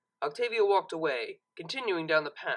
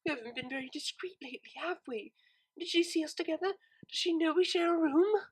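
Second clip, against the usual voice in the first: lightly voiced, higher anxious mare; fretting to herself, half under her breath; worried pony mare; 10 semitones higher than usual. A narrator's reading. Mare worrying someone saw them together, anxious voice. Octavia frets alone.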